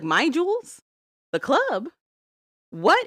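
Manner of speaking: high, baffled questions; confused surprise. Retold or imitated. Old man acting out his confusion, high baffled voice. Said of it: "He's like".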